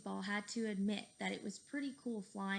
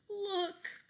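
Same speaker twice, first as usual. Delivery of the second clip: alarmed shout; 10 semitones higher, 5 dB louder than usual. Acted. Pointing at steamroller.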